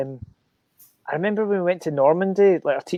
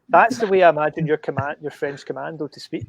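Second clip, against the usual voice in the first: usual speaking voice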